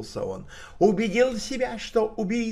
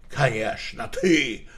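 barking; 7 semitones lower, 4 dB louder than usual. A narrator's reading. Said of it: "рявкнул Стэнли".

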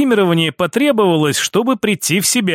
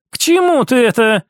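higher, livelier question; woman's character voice; lightly voiced sister; 5 semitones higher than usual. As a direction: throw the question out on a lifted pitch. Sister echoes his question.

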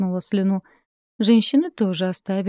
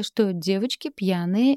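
ordinary delivery

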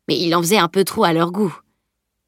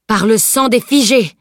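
loud, higher outraged oath; furious cry; 4 semitones higher, 6 dB louder than usual. A narrator's reading. Insulted knight answers being called a liar, furious cry. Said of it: "cria-t-il".

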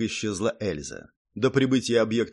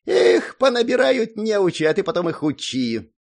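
high, loud grumbling gnome; grumpy complaint; 10 semitones higher, 5 dB louder than usual. A narrator's reading. Gnome complaining about untrained recruits, grumpy. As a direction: complain like a fed-up little gnome. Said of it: "ворчливо пожаловался Зак".

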